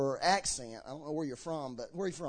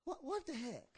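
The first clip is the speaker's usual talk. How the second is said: soft, fed-up God, a touch higher; mock impatience at endless prayer; exasperated God; 7 semitones higher, 10 dB quieter than usual. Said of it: "God's gonna say".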